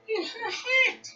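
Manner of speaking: mock-shocked repeat for the kids; astonished echo. Shown as a demonstration. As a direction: repeat it in amazed disbelief.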